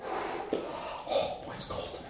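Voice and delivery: whispered, shivering cold. Retold or imitated. Peter warming himself by the fire, shivering whisper. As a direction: shiver through it.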